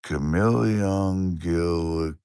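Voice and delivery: very slow, drawn out "Chameleon Gilly". Shown as a demonstration. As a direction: stretch "Chameleon Gilly" syllable by syllable. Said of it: "I'm gonna try to say your name again".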